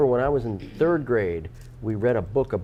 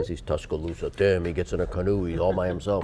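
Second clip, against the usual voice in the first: Godfather voice; 7 semitones lower, 4 dB quieter than usual. Acted. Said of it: "why did he sound like the Godfather".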